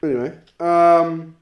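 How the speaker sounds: usual speaking voice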